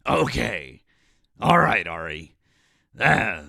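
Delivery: loud, throat-straining ranter impression; Alex Jones. Host trying a requested voice for co-streamer, loud straining rant. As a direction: belt it out like a throat-wrecking radio ranter. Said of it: "read it in an Alex Jones voice".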